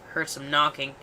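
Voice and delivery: plain reading voice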